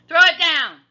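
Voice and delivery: high, very loud pirate shout. A narrator's reading. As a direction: bellow it up. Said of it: "shouted the captain".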